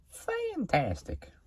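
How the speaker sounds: drawn-out, high Texas twang; Texas accent. Pretend. Car fan enthusing about practice in a put-on Texas accent. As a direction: stretch it out in a high Texas drawl. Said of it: "I just use a Texas accent".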